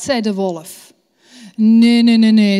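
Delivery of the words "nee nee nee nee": louder insistent no, no; loud protest. A narrator's reading. Bear loudly contradicting the wolf. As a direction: shout down the wolf's boast.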